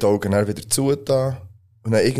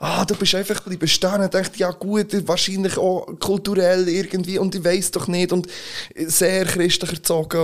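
irritated; 8 semitones higher than usual. Retold or imitated. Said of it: "plötzlich so ein bisschen hässig geworden ... Und ich dann auch".

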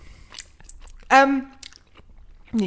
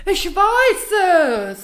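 triumphant; 7 semitones higher, much slower than usual. Real, not acted. Triumphant idea about candy.

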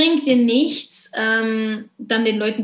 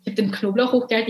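usual speaking voice

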